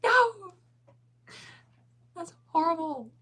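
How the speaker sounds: high, loud cry of dismay easing off; high-pitched protest; dismayed outcry. Real, not acted. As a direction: yelp it high, playfully appalled. Reacts to a chat comment.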